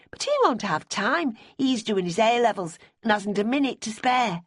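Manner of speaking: a hint of a brisk young girl; Bonnie's voice. A narrator's reading. Bonnie explaining Ben is busy, lightly voiced young girl.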